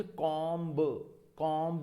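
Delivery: कॉम्ब with the final "b" sounded; common mispronunciation. Shown as a demonstration. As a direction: open "kawm" then a released "buh" in कॉम्ब. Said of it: "Normally हम इसे".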